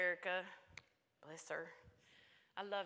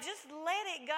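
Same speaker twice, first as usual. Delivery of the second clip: higher-pitched retold urging; fervent encouragement; 7 semitones higher, 4 dB louder than usual. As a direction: press it home to your child with feeling. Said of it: "I was like".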